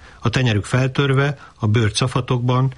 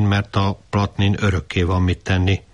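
ordinary delivery, nothing marked

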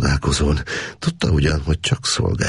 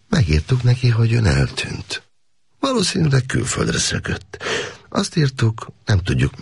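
a hint of a higher, coolly explaining officer; lightly voiced officer; 8 semitones higher than usual. A narrator's reading. Officer explaining the letter to the prisoner, lightly voiced. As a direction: explain it matter-of-factly, lightly in character.

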